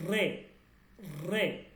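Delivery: রে with a strongly trilled r; emphatic trilled "rrre" for রে; rolled r. Shown as a demonstration. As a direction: roll the r at the start of রে, firmly. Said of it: "আর এইটার উচ্চারণ হচ্ছে".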